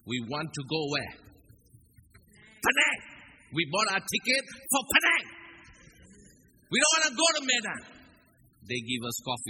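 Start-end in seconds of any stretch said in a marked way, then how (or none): 2.6-7.8 high, loud, fast mock complaint; hammed-up angry passenger; frustrated complaint. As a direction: demand Penang, much higher and louder.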